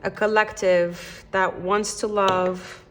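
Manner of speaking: slow, hushed prayer to the spirit guides; tarot reader praying to her spirit guides, slow and hushed; prayerful invocation. Acted. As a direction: call gently on the angels, unhurried.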